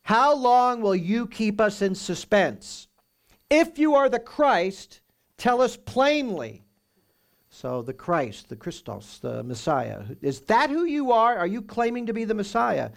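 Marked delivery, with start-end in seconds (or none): 0.0-6.6 high, loud, slow demand; demanding authorities. Acted. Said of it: "they say this". 10.2-12.9 high, louder demand; challenging question. Acted. Authorities challenging Jesus.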